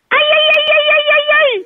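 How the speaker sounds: very high falsetto "ay, ay, ay" moans, mocking; mock moaning. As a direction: squeal the "ay"s high like a porn clip. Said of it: "all I hear is".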